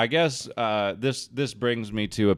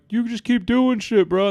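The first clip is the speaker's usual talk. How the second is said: mocking bro; 14 semitones higher, 6 dB louder than usual. Filming guys mocking him.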